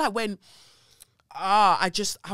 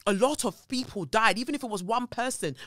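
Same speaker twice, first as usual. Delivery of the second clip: louder and higher, people died hammered home; angry outburst; 6 semitones higher, 6 dB louder than usual. Real, not acted. Rejecting spiritual excuses for the Grenfell deaths, angry and loud. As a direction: snap with real anger.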